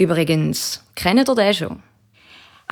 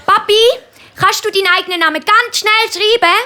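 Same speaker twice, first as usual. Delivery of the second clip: sehr hohe Bubenstimme; fragender Bub; 13 semitones higher, 4 dB louder, faster than usual. A narrator's reading. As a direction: frag den Papi hoch wie ein Kind. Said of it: "will der Markus wissen". (sehr hochi Buebestimm)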